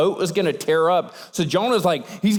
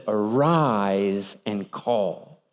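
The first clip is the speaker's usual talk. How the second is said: much lower and softer, very slow; low slow echo; 10 semitones lower, 3 dB quieter, much slower than usual. Acted. Sea captain echoing God's command, low and slow. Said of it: "What does the captain say?".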